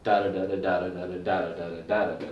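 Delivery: quick da da da drum syllables; vocal drum imitation. Shown as a demonstration. Teacher to drum class, vocalizing the rhythm quickly. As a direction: mimic the paradiddle with your voice, fast. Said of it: "A paradiddle sounds like it sounds".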